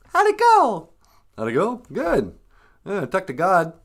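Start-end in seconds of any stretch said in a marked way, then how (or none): 0.1-0.9 grandma voice. Acted. High grandmother voice asks.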